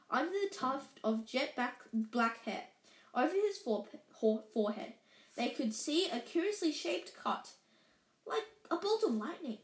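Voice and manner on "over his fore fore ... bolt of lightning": ordinary delivery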